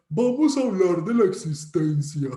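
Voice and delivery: hammed-up, loud call to debate; mock philosophy debater. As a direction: announce a big topic mockingly. Mocks solemn philosophy debaters.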